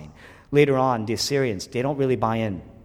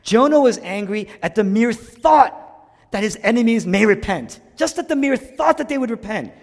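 higher, louder fervent preaching; preaching at full force; 9 semitones higher, 6 dB louder than usual. Real, not acted. Preacher proclaiming to the congregation at full force. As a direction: drive it home to the room.

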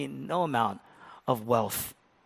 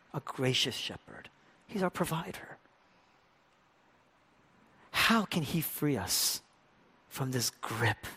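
normal talking voice

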